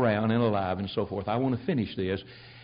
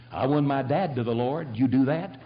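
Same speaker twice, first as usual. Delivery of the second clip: ordinary talk, nothing marked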